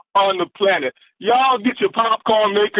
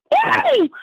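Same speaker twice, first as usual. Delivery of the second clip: high whooping cheer; real excited whoop; excited woo. Real, not acted. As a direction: whoop with joy. Host cheering the theme.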